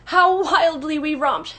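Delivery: high, slow, drawn-out reminiscence; wistful recollection. A narrator's reading. Mrs. Darling recalling the last romp, wistfully. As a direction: linger wistfully over the memory. Mother fondly recalling the lost evening.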